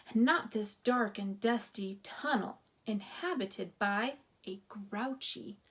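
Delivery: ordinary delivery, nothing marked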